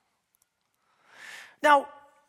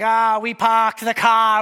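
put-on loud, slow Boston accent with dropped r's; hammed-up Boston, "pahked the cah"; 7 dB louder, slower than usual. Bostonian caught at the guards' test, broad Boston accent. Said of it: "except if you're from Boston".

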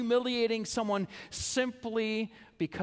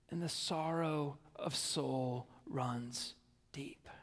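slow, much softer, nearly whispered; hushed, sorrowful; 6 semitones lower, 9 dB quieter, slower than usual. Acted. Preacher to congregation on sorrow, slow and hushed. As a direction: let it sink slowly into silence.

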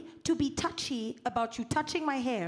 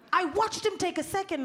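ordinary delivery

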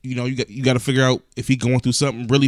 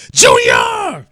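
calling out; 11 dB louder than usual. Acted. Calling out a name.

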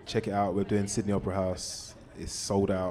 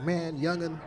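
much higher put-on voice of an old soul singer; 10 semitones higher, slower than usual. Old singer backstage greeting a young musician, much higher put-on voice. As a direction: jump way up in pitch to play the elder greeting you. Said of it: "I don't wanna do, like, a bad impression of him".

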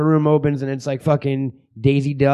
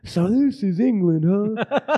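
slow, higher mock tourist voice; wide-eyed tourist; 5 semitones higher, much slower than usual. Host voices his friend landing in England, mock wide-eyed. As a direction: put on a slow, marveling newcomer voice.

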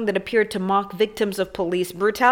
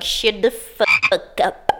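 mock celebrity clapback; much slower than usual. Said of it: "other celebrities were like".

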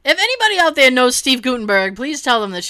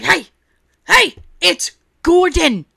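high, loud, slow mock yell; stretched, raised mock shout; Gordon flipping out; 6 semitones higher, 6 dB louder, slower than usual. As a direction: imitate an irate chef calling someone out. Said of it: "he starts flipping out on the guy".

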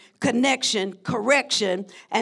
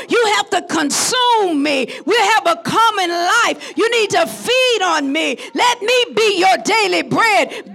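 higher, louder, crying preacher's shout; full-force preaching; 6 semitones higher, 6 dB louder than usual. Acted. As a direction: whoop the words of Jesus, building. Preaching Jesus' words at full force.

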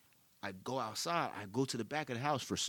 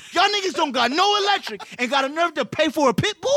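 high, loud mock outrage; scolding friends over the dog, outraged; 14 semitones higher, 11 dB louder than usual. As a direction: blow up in playful disbelief.

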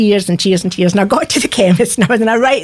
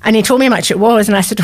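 normal talking voice

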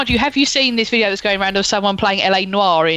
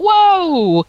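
loud, high astonished whoa; amazed whoa; 12 semitones higher, 4 dB louder than usual. Retold or imitated. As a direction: gasp it out in awe. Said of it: "And he's like".

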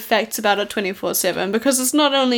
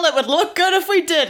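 higher, keen repeat of a friend's words; eager echo; 7 semitones higher than usual. Acted. Goku overruling Kurita before jumping down, eager. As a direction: seize on the idea, pitched up.

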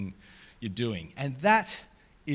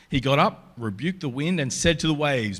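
a measured, louder Bible reading to the congregation; scripture reading; 4 dB louder than usual. Acted. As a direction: read it out to the room like a lesson. Reads scripture passage aloud.

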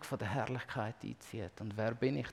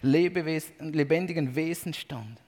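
loud, high, proclaiming reading of the heavenly praise; scripture reading; 4 semitones higher, 8 dB louder than usual. Acted. Preacher reading Revelation to congregation, loud proclaiming voice. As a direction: read the vision as if shouting praise with the crowd. Reads Revelation aloud with fervor.

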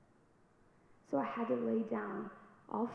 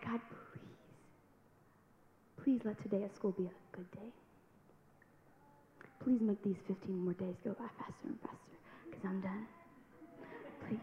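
softer, pleading whisper; whispered prayer; 4 dB quieter than usual. Retold or imitated. Praying before school, whispered plea. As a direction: plead under your breath.

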